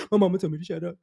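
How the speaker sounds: normal talking voice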